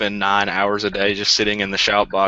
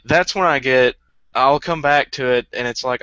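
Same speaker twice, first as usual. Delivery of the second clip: usual speaking voice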